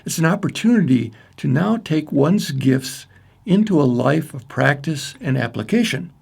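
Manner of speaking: ordinary delivery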